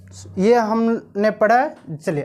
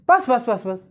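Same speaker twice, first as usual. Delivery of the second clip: "बस" repeated quickly in a string; rapid repetition. Shown as a demonstration. Teacher showing intonation to students, hurried repeated "बस". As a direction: fire off "बस" several times without a gap. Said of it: "ध्वनि में उतार चढ़ाव होता है".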